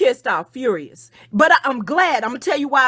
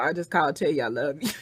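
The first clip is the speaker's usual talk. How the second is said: fast, softer, laughing it off; giggly, hurried I love you; 7 semitones lower, 5 dB quieter, faster than usual. Real, not acted. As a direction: laugh through the sweet line after venting. Laughing apology mid-rant.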